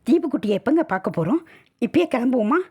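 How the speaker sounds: high, quick, excited questions; eager asking. Acted. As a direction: ask impatiently, raring to set off. Eager to see the child. (ஆவலான, பரபரப்பான கேள்வி)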